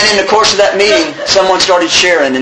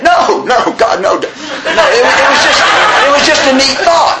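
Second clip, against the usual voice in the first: high, louder protest with a laugh; alarmed refusal; 9 semitones higher, 3 dB louder than usual. Acted. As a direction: back out fast, voice jumping up. Acted refusal to quit today.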